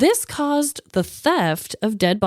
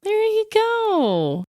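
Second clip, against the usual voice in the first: high, slow and stretched out; drawn-out high; 11 semitones higher, slower than usual. Real, not acted. Sums up the dilemma.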